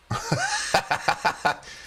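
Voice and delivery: cracks up at his own joke; big laugh at the bit; high laugh. Real, not acted. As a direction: free laughter.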